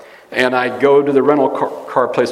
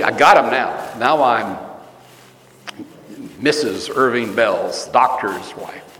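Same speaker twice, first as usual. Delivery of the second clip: mock smug boast, drawn out; smug mockery; 3 semitones lower, slower than usual. Mocking the wife bragging, smug. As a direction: gloat like a new doctor's wife. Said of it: "Big deal to her".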